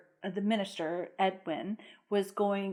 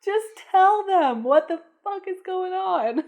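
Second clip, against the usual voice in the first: exasperated outburst; 9 semitones higher than usual. Real, not acted. Venting at movie character.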